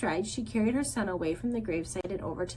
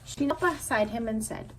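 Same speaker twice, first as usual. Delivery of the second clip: ordinary delivery, nothing marked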